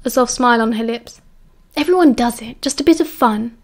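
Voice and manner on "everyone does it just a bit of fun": lightly coaxing; cajoling. A narrator's reading. Mary excusing herself to Lily, cajoling. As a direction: gently play it down to win her over. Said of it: "Mary cajoled".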